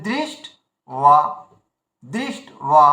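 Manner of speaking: দৃষ্ট, a clear pause, then ওয়া; split word. Shown as a demonstration. Teacher models the three-letter cluster, দৃষ্ট and ওয়া held apart. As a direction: stop after the consonants of দৃষ্ট before ওয়া. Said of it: "এভাবে উচ্চারণ করতে হবে".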